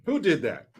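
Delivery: usual speaking voice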